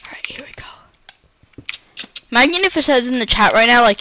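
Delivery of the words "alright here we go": hushed whisper to himself. Real, not acted. Gamer whispers to himself before going. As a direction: breathe it out, barely voiced.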